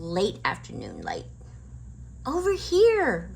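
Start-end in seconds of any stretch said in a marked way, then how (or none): 2.2-3.3 slow, high, loud call. A narrator's reading. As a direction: call across the trees. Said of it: "called Annie".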